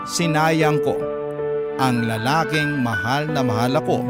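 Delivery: usual speaking voice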